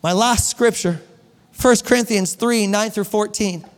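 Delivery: ordinary talk, nothing marked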